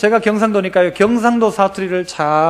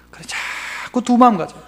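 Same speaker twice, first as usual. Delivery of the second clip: breathy whispered rebuke; hushed scolding aside; 6 dB quieter than usual. Real, not acted. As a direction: whisper it like a sigh. Aside to congregation.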